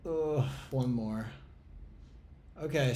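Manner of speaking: ordinary delivery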